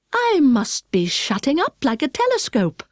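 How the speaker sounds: Alice realising she is shrinking, lightly excited; amazed Alice, lightly voiced. A narrator's reading.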